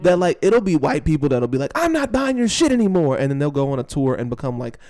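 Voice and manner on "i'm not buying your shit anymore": high, loud mock of an outraged customer; mocking quote.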